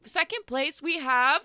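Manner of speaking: chirpy festival host calling the crowd in; sugary village host, high and beaming; Lila the host. Acted. Lila announces second place.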